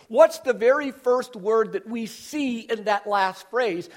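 ordinary talk, nothing marked

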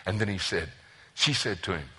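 normal talking voice

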